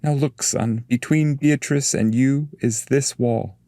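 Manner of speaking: a touch of impatience with a stubborn pupil; a little upset. Acted. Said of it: "he said a little upset".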